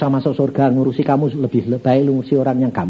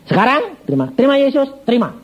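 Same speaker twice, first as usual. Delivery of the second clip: high, emphatic repeated command; urgent exhortation; 10 semitones higher than usual. Retold or imitated. Preacher pressing banker to accept Jesus, urgent. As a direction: urge him insistently, pitch well up.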